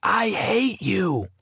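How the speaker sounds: high, slow scream of hate. A narrator's reading. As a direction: scream it at him, high and drawn out. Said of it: "I screamed".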